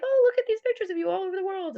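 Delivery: a husband pleasantly admiring the photos; imitating husband. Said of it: "my husband's like".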